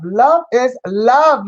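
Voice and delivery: Love is love, slow and stretched; second take, slow and fuller; drawn-out love. Acted. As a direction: savour each word slowly. Repeats it drawn out.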